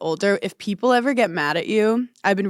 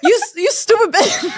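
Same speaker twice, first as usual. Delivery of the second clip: laughing mock insult; 11 semitones higher, 4 dB louder than usual. Bully repeating insult, laughing.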